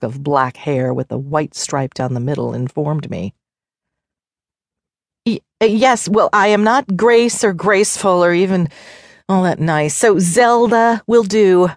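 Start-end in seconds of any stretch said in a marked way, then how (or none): 5.3-11.7 mildly flustered snap, pitched higher; a touch of irritation, stumbling at first; snapped. A narrator's reading. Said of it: "I snapped".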